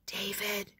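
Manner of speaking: soft hushed whisper. Acted. Julie hushing David near the big table, whispered. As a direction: breathe his name so nobody hears. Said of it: "whispered Julie".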